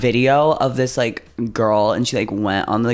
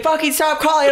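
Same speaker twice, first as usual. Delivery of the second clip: mock furious yell. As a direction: bark it at the caller like the neighbor. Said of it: "screaming at me".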